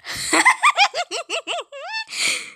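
long, very high eerie laugh; creepy doll laugh. Acted. The possessed Annabelle doll laughing at the family, high and eerie.

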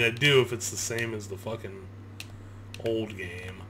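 ordinary delivery

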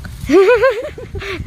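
high-pitched burst of laughter. Real, not acted. Friend reacting to joke about positive result, high laugh.

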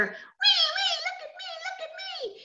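very high, thin squeals of delight; gleeful. Acted. Boy showing off on the swing, high gleeful squeal. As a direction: squeal it high and sing-song.

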